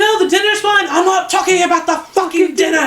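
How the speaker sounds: high, shouted imitation of a mom snapping; hammed-up cursing meltdown; mock upset outburst. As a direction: yell it high like a mom losing it. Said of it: "Yeah, she just curses".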